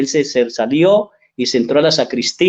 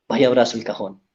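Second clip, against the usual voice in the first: gentle, softer retold urging; softer repeat; 4 semitones lower, 5 dB quieter than usual. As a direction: repeat the advice softly, coaxing the father. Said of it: "pero le dije". (repetición más suave y persuasiva)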